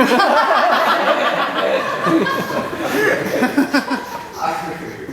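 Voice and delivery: high, loud burst of laughter; a full, high-pitched laugh that falls away; real big laugh. Real, not acted. Big laugh at his own joke.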